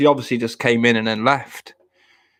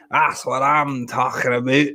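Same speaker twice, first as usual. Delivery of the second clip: "That's what I'm talking about" slower in a put-on character voice. Shown as a demonstration. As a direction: drag it out in a mock catchphrase voice.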